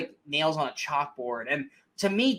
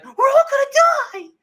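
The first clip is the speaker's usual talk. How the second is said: mock shrill scream, very high and loud; Jennifer Lawrence screaming; 12 dB louder, much faster than usual. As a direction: shriek it as overdone movie panic. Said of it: "she gets back to that".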